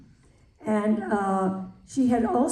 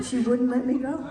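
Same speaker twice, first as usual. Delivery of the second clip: normal talking voice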